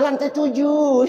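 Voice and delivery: high, prissy one-word answer; mock prim lady. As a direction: play the showy lady going to class.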